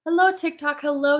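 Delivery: ordinary talk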